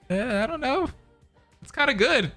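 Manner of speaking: high-pitched, soft noncommittal voice; sheepish reply. Retold or imitated. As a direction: imitate yourself making a small, high-pitched excuse. Acts out own reply to girlfriend.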